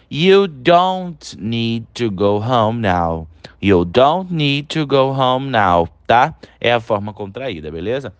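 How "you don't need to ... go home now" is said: slow, careful read of "don't" sentence; slow model sentence. Shown as a demonstration. Teacher modelling the contracted sentence, slow and careful. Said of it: "a forma contraída desse verbo aí".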